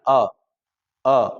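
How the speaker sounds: a short, isolated a vowel, said twice; short a sound. Shown as a demonstration. Teacher demonstrates the short a to students. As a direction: voice the short a alone, from mid tongue. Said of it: "बोलो, छोटा आ बोल रहा है".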